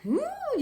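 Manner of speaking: soft, very high "hmm"; mock knowing hum, pitched right up; high expressive hum. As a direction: hum high in an imitated reaction. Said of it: "they talk like".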